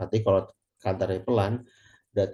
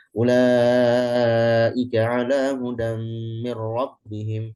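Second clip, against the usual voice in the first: chanted louder with a higher, held voice; hadr recitation; 3 semitones higher, 5 dB louder, much slower than usual. Shown as a demonstration. Teacher demonstrating the hadr recitation style. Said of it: "Ulaika itu hadar".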